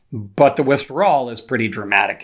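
ordinary talk

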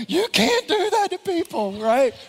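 very high, loud comic protest; mock outrage. Pastor retelling reaction to detective's mistake, mock outraged. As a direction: exclaim in exaggerated indignation for laughs.

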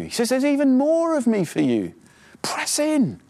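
high, impassioned sermon voice pressing the point; preaching at full force. Acted. Pastor urging his congregation to hunger for God, fervent preaching. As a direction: urge the church on, voice lifted high.

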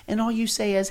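ordinary talk, nothing marked

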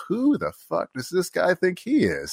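high, drawn-out indignant disbelief; indignant retelling. Retold or imitated. Firefighter recalls being challenged by a colleague, indignant. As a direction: stretch it out high, affronted by his nerve.

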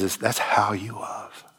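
hushed, breathy closing whisper. Real, not acted. Preacher whispers the moral to the congregation, hushed. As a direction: let the point land in a whisper.

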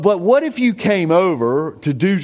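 ordinary talk

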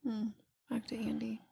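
soft aside, barely voiced; whispered handover to her co-host. Real, not acted. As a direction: whisper the hand-off. Handing the talk back to Andy.

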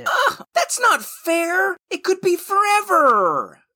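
exasperated high wail of complaint; whiny protest. Acted. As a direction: protest like a thwarted apprentice. Apprentice protests being left stuck.

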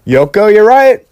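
mock self-satisfied John; hammed-up vindicated agreement; John's smug take. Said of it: "But John takes it as".

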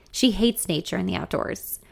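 usual speaking voice

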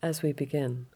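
calm yoga nidra guide, leaving space after each phrase; guided relaxation. Acted.

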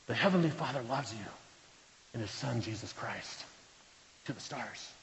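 a pastor softly pleading with his sick friend; tender hush. Real, not acted. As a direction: drop to a soft, tender near-hush. Pastor gently urging agnostic Rick.

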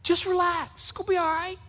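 soothing Jesus reassuring his frightened disciples. Acted. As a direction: hush them gently: I got this. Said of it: "that's what Jesus is saying".

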